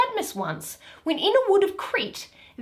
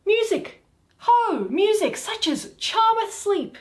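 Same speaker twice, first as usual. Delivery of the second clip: eager call for music; 8 semitones higher than usual. Acted. Titania eagerly calling for sleep music. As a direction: sing out for the charm music.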